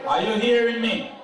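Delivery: loud, firm call to the room; teacher voice. Shown as a demonstration. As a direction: demand the room's attention, teacher style. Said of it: "put on my teacher voice".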